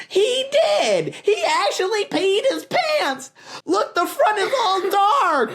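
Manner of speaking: put-on very high jeering boy voice; mocking kid. A narrator's reading. A playground boy jeering at Peter, high mocking laugh.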